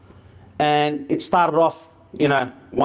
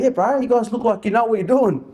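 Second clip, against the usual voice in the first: put-on young fella voice; 8 semitones higher than usual. Young fella voiced in retold story.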